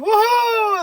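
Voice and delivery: big high cheering whoop; crowd cheering. Retold or imitated. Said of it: "everyone's like".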